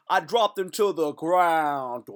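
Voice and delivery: loud, drawn-out victory call; mock triumphant boast. Crowing after dropping the fan, mock triumphant boast. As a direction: stretch it big like a hammed-up champion.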